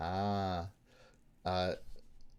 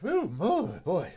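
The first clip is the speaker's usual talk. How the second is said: about to sneeze; 9 semitones higher, 7 dB louder, slower than usual. Real, not acted. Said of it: "Sorry, I have to sneeze".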